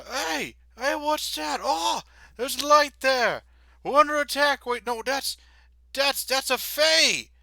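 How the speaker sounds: high, loud panicked shouting; alarmed guards. Acted. Guard shouting to comrades about an attack.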